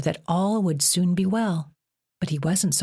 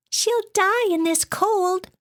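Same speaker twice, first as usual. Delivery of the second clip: much higher little weasel voice; slower than usual. A narrator's reading. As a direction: plead quickly as a small weasel. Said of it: "the weasel said".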